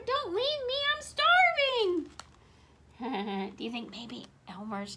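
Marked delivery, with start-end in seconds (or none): 0.1-2.1 very high, drawn-out wail, building louder; pleading call. A narrator's reading. Stranded Wilbur begs departing cousin, high wailing plea. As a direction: wail it after someone leaving. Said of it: "called Wilbur".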